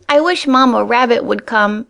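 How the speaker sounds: high little squeak of fright; a scared bunny calling for mother; frightened squeak. A narrator's reading. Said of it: "he squeaked".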